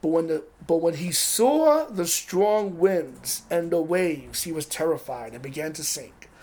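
ordinary talk, nothing marked

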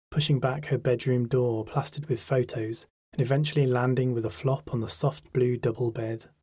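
plain reading voice

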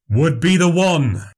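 lightly voiced showman, a bit peeved; peeved but composed. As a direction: keep it together while annoyed. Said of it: "peeved but keeping it together".

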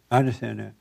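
normal talking voice

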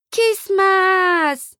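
long high wail, loud; a toddler howling in protest. Acted. Toddler being carried away, loud wail. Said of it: "the little girl wails".

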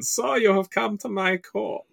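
high-pitched campy German accent, vampire royalty; hammed-up German-accented vampire prince receiving a guest. Shown as a demonstration. Vampire prince of Berlin greets visitor, campy German accent. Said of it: "the Vampire Prince of Berlin".

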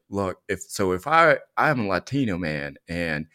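normal talking voice

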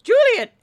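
"Juliet" with a loud, high punch on the first syllable; teacher's pronunciation. Shown as a demonstration. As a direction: hit the start of "Juliet" hard, as a fussy teacher would. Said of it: "It's supposed to be pronounced".

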